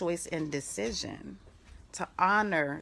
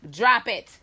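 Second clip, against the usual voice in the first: loud sharp command; firm shout; 4 semitones higher, 10 dB louder than usual. Real, not acted. To her dog chewing a shoe, a loud firm command. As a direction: bark it at the dog.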